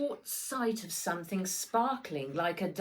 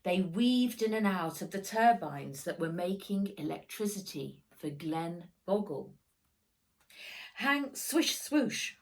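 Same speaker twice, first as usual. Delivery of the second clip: ordinary delivery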